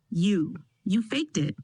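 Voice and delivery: shocked halting stammer. A narrator's reading. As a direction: stumble over the words. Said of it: "Trevor stammered".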